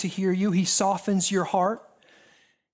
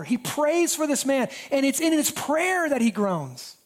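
high, ringing preacher's climax; preaching at full force; 6 semitones higher than usual. Acted. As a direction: lift the congregation with rising fervour. Preaching climax, raised pitch.

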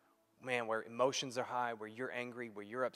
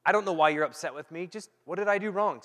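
raised pleading voice; 5 semitones higher, 10 dB louder than usual. Retold or imitated. Pleading question in heated moment.

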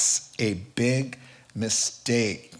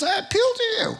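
squeaky falsetto timid apostle, much higher; mock high Paul; 3 dB louder, much faster than usual. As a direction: squeak it like a meek little man. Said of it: "So he says".

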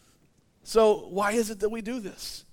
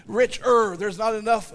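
normal talking voice

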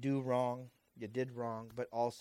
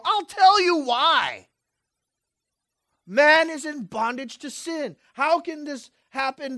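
loud, high confession litany; preaching at full force; 9 semitones higher, 12 dB louder than usual. Acted. Preacher voicing sinners' confessions to the church, loud. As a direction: hammer each I was.

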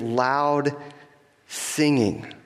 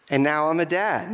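usual speaking voice

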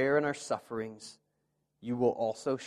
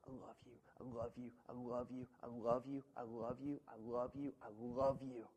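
soft repeated whisper of love; 11 dB quieter than usual. Acted. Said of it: "hear in their minds over and over".

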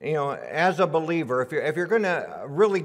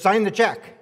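stern command; 5 semitones higher, 5 dB louder, slower than usual. Retold or imitated. Little teller slaps the customer.

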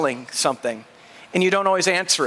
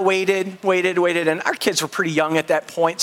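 normal talking voice

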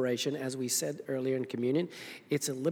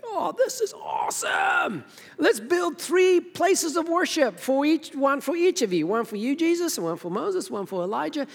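hammed-up overexcited disciple, much higher voice; Peter; 12 semitones higher, 4 dB louder than usual. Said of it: "Peter does his usual foot and mouth thing".

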